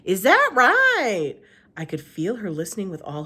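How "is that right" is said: Gloria Dump voice. Acted.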